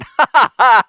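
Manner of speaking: high, loud burst of laughter; real laugh, high and loud. Real, not acted. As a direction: crack up at your own remark. Laughs at own remark.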